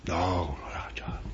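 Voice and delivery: breathless whispered disbelief; whispered denial. Retold or imitated. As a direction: hush it, refusing to believe. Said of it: "They said".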